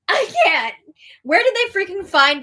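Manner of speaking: high, loud throwing-up-hands cry; exasperated. Real, not acted. Host overwhelmed by the drama, exasperated. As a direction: burst out in overwhelmed disbelief.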